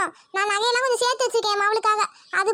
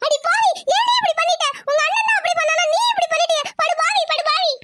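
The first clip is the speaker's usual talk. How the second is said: higher, wailing rebuke; anguished scolding; 5 semitones higher than usual. Acted. Mother rebukes daughter for eloping, anguished wail. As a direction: curse her like she broke your heart. Mother learns of secret marriage.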